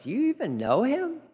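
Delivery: ordinary delivery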